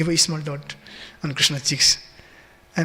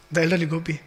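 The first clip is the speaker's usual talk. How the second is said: normal talking voice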